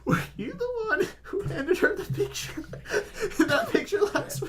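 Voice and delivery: high laughing disbelief; laughing accusation. Real, not acted. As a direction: cackle out the question.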